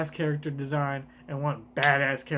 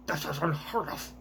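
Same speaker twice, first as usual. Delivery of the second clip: a soft, scornful noble voice; celestial dragon voice; 5 dB quieter than usual. Acted. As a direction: sniff at it like a spoiled aristocrat. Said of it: "I give the worst voices to all of the, uh, celestial dragons".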